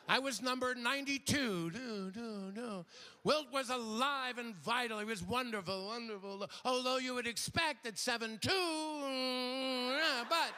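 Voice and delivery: high, fond testimony with echoes; woman at podium. Pretend. Ex-lover telling the convention about Wilt in a high voice. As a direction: reminisce at the mic with a sigh.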